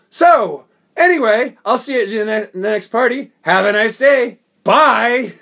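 loud, high, breezy sign-off; mock-cheery farewell. Cruel prankster leaving the woman he humiliated, loud fake cheer. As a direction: sing out a falsely friendly goodbye, loudly. Acting his breezy parting shot.

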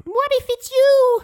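very high, loud, drawn-out mock female voice; Tia Carrere. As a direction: squeal it slowly as a hammed-up actress impression. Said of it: "That's my Tia Carrere impression".

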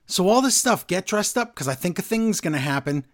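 ordinary talk

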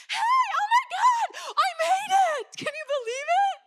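high, shouted, laughing joy; excited greeting. Retold or imitated. Wife arriving late, shouting happily and laughing. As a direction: squeal it like she just won something. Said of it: "she's like".

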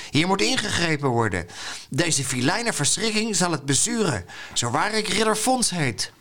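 high-pitched, grandiose vow with swooping tune; self-assured knight. A narrator's reading. Knight Fons vowing to chase the witch, self-assured. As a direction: declaim it as a pompous little hero. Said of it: "die zich wat zekerder begon te voelen".

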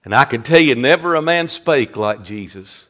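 low, soft, weighty sermon delivery; hushed preaching. Real, not acted. Preacher marvelling at Jesus before the congregation, hushed preaching. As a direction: drop right down and let it sink in.

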